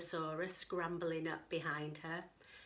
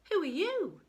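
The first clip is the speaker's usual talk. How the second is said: curious; 9 semitones higher, 6 dB louder than usual. Acted. Katie asks the dinosaur.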